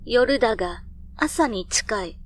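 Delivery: plain reading voice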